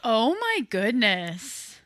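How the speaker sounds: slow, stretched oh my goodness; drawn-out amazement. Real, not acted. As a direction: draw out each word in disbelief. Reacts to the final score.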